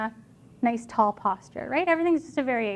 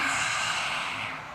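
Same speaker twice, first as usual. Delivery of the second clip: lion's breath exhale; 9 dB quieter than usual. Shown as a demonstration. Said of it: "we're gonna do lion's breath twice".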